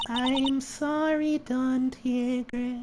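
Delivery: high, slow, soft meek voice; meek apology. Acted. Waitress apologises to Don Tigre, high meek voice. Acted timid character apologising to boss.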